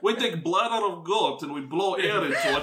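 hammed-up broken-English Russian, laughing; russian accent. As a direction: play a laughing Russian sailor. Mock Russian sailor explaining life vests.

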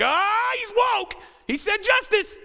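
high, shouted mock outcry; mock alarm. Preacher mimicking critics of the word justice, shouted mock alarm. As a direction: shriek it like a hammed-up accusation.

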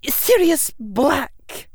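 slow, bitter, near hiss; almost hissing. Acted. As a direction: spit the name out. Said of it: "almost hissing the name".

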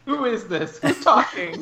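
playful high falsetto, laughing; man doing a woman's voice. Pretend. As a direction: squeak it out teasingly. Teasing the only woman on the podcast.

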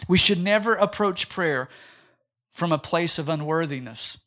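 ordinary delivery

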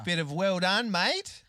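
bright Australian "well done, mate" lifted high; mock Aussie praise. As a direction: toss it off like a cheeky Aussie mate.

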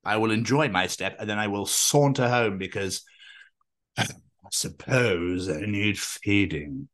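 lightly voiced snooty cat, quiet and slow; haughty cat voice. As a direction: play a disdainful cat, softly. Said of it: "cats would be like".

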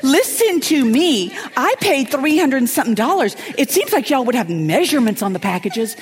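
high indignant complaint. Retold or imitated. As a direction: scold the company in her high voice. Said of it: "I'm like".

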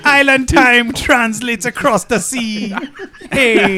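slow, stretched fake Jamaican accent; lazy mock Jamaican lilt; put-on Jamaican accent. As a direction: draw it out in a joking Jamaican accent. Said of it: "I was putting on the Jamaican accent".

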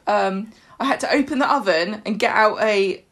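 ordinary delivery

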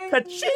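high cash-register ka-ching; ka-ching sound effect. Acted. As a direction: sing out a bright ka-ching. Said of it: "I'll make sound effects".

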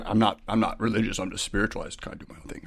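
mock the self-made spirituality under your breath; mock airy spiritual type. Mocking a spiritual-not-religious person.

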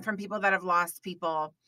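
usual speaking voice

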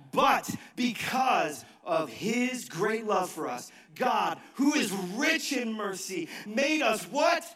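call and response preaching. Acted. Pastor prompting the congregation to answer, slow rising call. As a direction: draw the answer out of the crowd.

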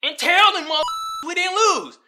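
mock rioter rallying the crowd. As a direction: pump up the mob in a mock voice. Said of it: "he was like".

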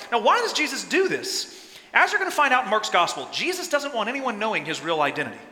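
ordinary talk

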